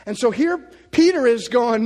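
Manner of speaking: loud, driving preacher voice. Acted. Preacher scolding modern views of Jesus, loud and driving. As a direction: press the point home hard.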